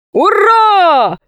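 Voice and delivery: high, long-held hurrah; exultant captain's cheer; triumphant shout. A narrator's reading. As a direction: cry out in victory. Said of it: "закричал Синдбад-мореход".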